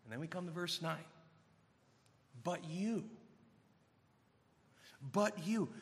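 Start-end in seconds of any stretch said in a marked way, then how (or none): 2.4-5.7 "But you" dropped to a much softer, hushed voice; hushed emphasis. Shown as a demonstration. Preacher rereading "But you" to his flock, hushed. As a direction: lean in and let "But you" fall quietly. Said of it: "let me just carefully emphasize".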